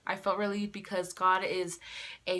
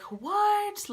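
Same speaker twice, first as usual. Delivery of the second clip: high, incredulous yelp; acted shock; 12 semitones higher than usual. Retelling her reaction to the faint line, high shocked question. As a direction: shoot way up in pitch, disbelieving.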